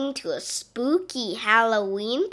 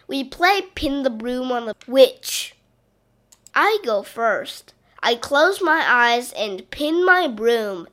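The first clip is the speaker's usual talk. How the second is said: ordinary delivery, nothing marked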